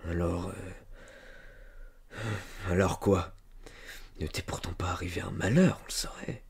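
low, hesitant musing; talking to himself. A narrator's reading. Farmer puzzling over the refusal, as if to himself. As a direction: drag it out, thinking under your breath. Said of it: "comme se parlant à lui-même".

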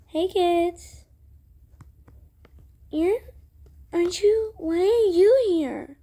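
ordinary delivery, nothing marked

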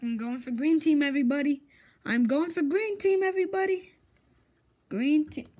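loud, high, fast announcement; calling out. Real, not acted. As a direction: proclaim it to everyone watching. Announcing team choice to viewers.

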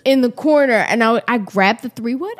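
usual speaking voice

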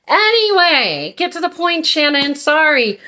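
high-pitched, playful telling-off of yourself; mock self-scolding. Rambling vlogger chides herself to get to the point, mock self-scolding.

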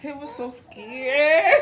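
usual speaking voice

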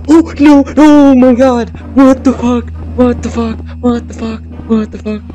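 freaking out at the monster; scared horror game streamer. Real, not acted.

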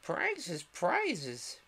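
high-pitched clamour; confused calling out. A narrator's reading. Whole party crowding Alice, calling out confusedly. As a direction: call it out high, like a jumbled crowd. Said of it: "calling out in a fu-, a confused way".

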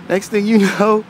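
ordinary delivery

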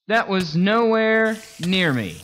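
high, loud, drawn-out protest; frustrated yell. Real, not acted. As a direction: yell in disbelief at an unfair hit. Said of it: "Seriously, getting a little frustrated".

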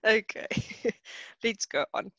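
trying to move on while laughing. Real, not acted. Teacher to class, laughing as she tries to continue. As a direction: giggles breaking into okay. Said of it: "I'm laughing so much now".

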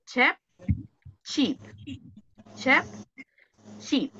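"Chep" rising with a soft E, then "chip" falling with a pure I; teacher contrasting the two vowels for her class; minimal pair drill. Shown as a demonstration. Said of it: "¿se pronuncian igual, sí o no?".

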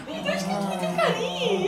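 high sing-song pet voice. Real, not acted. Baby talk to two dogs.

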